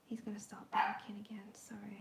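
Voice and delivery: hushed aside so the dog stays settled; whisper. Real, not acted. To viewers, whispering not to set the dog barking. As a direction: breathe the words with no voice.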